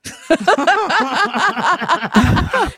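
high laugh climbing upward; loud, high, rising laugh; big rising laugh. Real, not acted. Laughs at blunt kids' remark.

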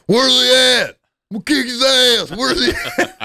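hammed-up jealous boyfriend looking for a fight; angry demand. Jealous boyfriend voiced in story.

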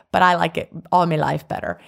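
usual speaking voice

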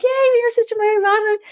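high excited welcome from the cast; excited cheer. Retold or imitated. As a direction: cheer like the whole cast greeting you. Cast cheerfully greeting her.

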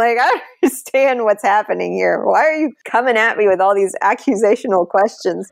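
reliving her baffled, indignant reply to the doctor; half-laughing disbelief, pushing back on the accusations; incredulous pushback. Retold or imitated. Mother confronted by an accusatory doctor.

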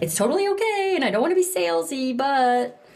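high-pitched timid imitation; mock hesitant backing-off; mock apologetic. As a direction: imitate an over-careful, apologetic seller. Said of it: "Instead of like".

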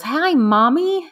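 put-on high, slow, small child's voice; little boy voice. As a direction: imitate her five-year-old son sweetly. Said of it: "he goes".

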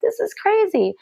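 mock awe; amazed. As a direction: gasp at the long line. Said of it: "And we're like".

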